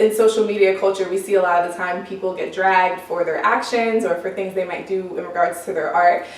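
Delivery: usual speaking voice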